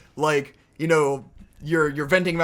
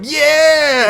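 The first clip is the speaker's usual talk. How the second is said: even louder, high mock holler; drunken yell; 9 semitones higher, 10 dB louder than usual. Drunk guests ruining every shot, loud high whoop. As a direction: belt it like a drunk mugging for the camera. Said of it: "someone going".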